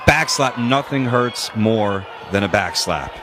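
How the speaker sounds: ordinary talk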